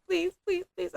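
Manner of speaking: high-pitched pleading gratitude, retold; touched, near tears. Massage therapist begging a grateful client to stop, touched. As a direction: imitate being overwhelmed by sweet praise. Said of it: "I will start crying".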